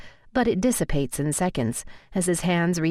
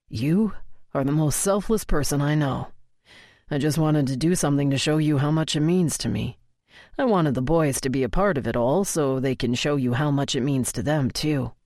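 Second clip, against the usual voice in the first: a man's earnest voice, pitched lower; lower male voice; 3 semitones lower than usual. A narrator's reading. A man telling his love why he did it. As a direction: voice the man gently, sincerely. Colton's tender speech.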